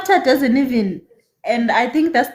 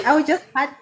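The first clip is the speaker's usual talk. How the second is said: normal talking voice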